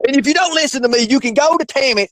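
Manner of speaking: mock rant, pitched high; Mark. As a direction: imitate a worked-up host sending listeners away. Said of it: "See, I'm practicing on it".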